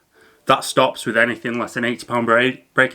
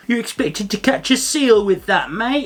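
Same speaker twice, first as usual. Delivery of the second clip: high-pitched mock Barnsley accent; 9 semitones higher, slower than usual. Facebook commenter scoffing at thick pike braid, high mock Barnsley accent. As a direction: jeer like a scoffing Barnsley angler in a much higher voice. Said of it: "That's my Barnsley accent".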